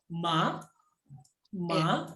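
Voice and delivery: "ma" starting low and sweeping up; rising second tone. Shown as a demonstration. Teacher corrects student's second tone, rising from below. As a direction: begin "ma" at the bottom and lift it. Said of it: "Dari bawah".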